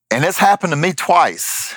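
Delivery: ordinary delivery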